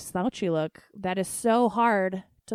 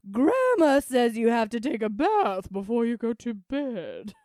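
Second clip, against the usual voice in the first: loud, high, wailing banshee; hammed-up banshee proclamation, swooping up and down; 4 semitones higher, 4 dB louder than usual. Playing banshee for a nephew about bath before bed, banshee voice. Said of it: "do your banshee voice".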